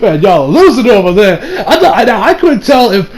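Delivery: ordinary delivery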